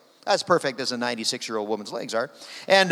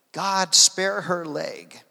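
slow but connected, pitched higher; solemn repeated prayer; 4 semitones higher, much slower than usual. Retold or imitated. Preacher repeats the translated prayer to the congregation, slow and lifted. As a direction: say it as one slow, pleading line.

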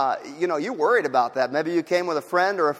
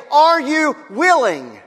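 slow, higher, louder cry; voice of the outcast; 6 semitones higher, 5 dB louder, slower than usual. Acted. The outcast calling to the church, pleading loudly. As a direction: draw it out as a plea ringing through the ages. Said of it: "in their own voice when they say".

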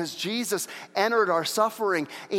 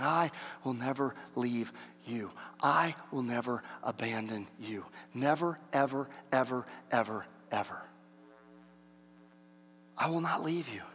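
soft, low, with weighty pauses between words; solemn promise; 4 semitones lower, 7 dB quieter than usual. Acted. God promising never to abandon His people, hushed and solemn. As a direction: let each "ever" drop quietly into silence.

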